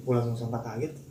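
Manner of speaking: usual speaking voice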